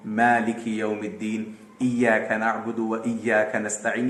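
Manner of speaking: gentle Arabic recitation following tajweed; careful tajweed recitation. Retold or imitated. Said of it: "ये इस तरीके से पढ़ता है".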